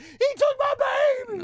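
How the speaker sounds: very high, loud screech; mimicked hysterical shriek. Sister screaming behind the police caller, mock hysterical shriek. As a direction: shriek it like a distraught mother in the background.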